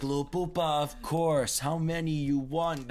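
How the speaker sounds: put-on a rough try at a Brooklyn accent; slow, high Brooklyn voice. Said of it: "I can't do a good, like, Brooklyn accent right now".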